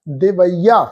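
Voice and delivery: ਦਿਵਈਆ with a clear ਈਆ ending; correct pronunciation. Shown as a demonstration. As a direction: end ਦਿਵਈਆ on a full ਈਆ. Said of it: "ਇੱਦਾਂ ਪਾਠ ਉਚਾਰਨ ਕਰਾਂਗੇ".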